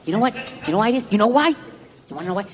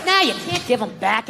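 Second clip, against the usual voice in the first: ordinary talk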